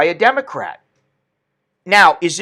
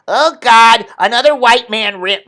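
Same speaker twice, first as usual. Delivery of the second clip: mock critic; 6 semitones higher, 5 dB louder than usual. Voicing dismissive critics.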